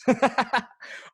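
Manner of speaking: short high burst of laughter. Real, not acted. Sales coach cracks himself up over an inside joke, laughing. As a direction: laugh at your own joke. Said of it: "that's just a joke".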